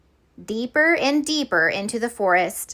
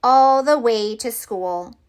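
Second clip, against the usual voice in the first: usual speaking voice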